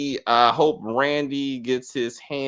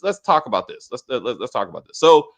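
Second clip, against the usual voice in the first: ordinary talk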